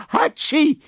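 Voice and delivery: high-pitched hammed-up "Hatschi!"; explosive storybook sneeze; acted sneeze. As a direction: gasp high, then burst out a big sneeze. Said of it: "dass er niesen musste".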